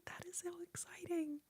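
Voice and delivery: high, thrilled whisper; excited whisper. Real, not acted. Streamer thrilled by eighteen thousand subscribers, excited whisper. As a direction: squeal it under the breath, delighted.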